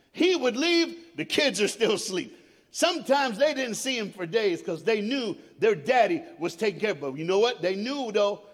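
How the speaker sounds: loud, rising preacher's cry; preaching at full force. Acted. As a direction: preach it hard to the congregation.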